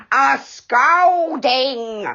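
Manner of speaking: slow, loud roar of the word scolding; angry pixie roaring the threat. A narrator's reading. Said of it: "roared the angry pixie".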